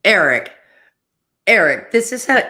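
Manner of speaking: loud exasperated "Eric, Eric"; exasperated calling out. Real, not acted. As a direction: call him out by name, loudly and exasperated.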